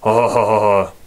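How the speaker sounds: lumbering giant's speech ending in a heavy laugh; Andre the Giant. Acted. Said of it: "That's my Andre the Giant impression".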